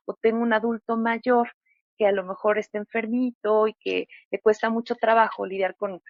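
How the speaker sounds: ordinary talk, nothing marked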